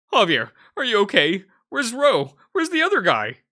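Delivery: high loud worried cries; frantic, checking on a friend; panicked. Acted. Rushes to injured friend.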